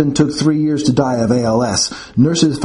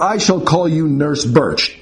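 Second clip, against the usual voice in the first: haughty professor; 4 semitones higher than usual. Acted. Haughty professor naming nurse.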